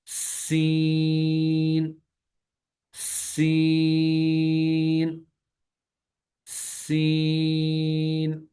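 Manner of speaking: سین with a long, drawn-out whistling s; سین held very slowly with a sharp whistle. Shown as a demonstration. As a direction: stretch the s of سین into a hiss. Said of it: "ساتھ ہی سیٹی کی آواز بھی آنی چاہیے".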